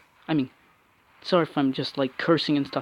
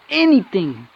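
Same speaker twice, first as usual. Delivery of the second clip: louder, higher insistent cry; emphatic repeat; 4 semitones higher, 6 dB louder than usual. Real, not acted. Player repeating his complaint loudly in frustration. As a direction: hammer the single word home. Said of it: "I said".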